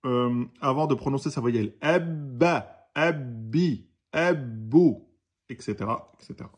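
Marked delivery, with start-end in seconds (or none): 1.8-5.0 Ebba, ebbi, ebbu, the bb held before each vowel; held double b. Shown as a demonstration. Teacher drilling vowels, bb held each time. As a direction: keep the b held, then release each vowel. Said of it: "Comme si on retenait la lettre eb".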